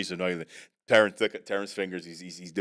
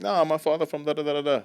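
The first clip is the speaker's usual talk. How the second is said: put-on Caribbean accent; 7 semitones higher than usual. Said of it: "these people were Caribbean".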